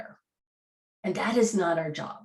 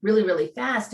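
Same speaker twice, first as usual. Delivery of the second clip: talking really fast; 6 semitones higher, much faster than usual. Shown as a demonstration. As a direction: rattle the words off at x1.9 speed. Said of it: "talking like".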